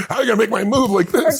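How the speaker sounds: laughing through the question, high and loud. Real, not acted. Laughing back at the host's joke, laughing.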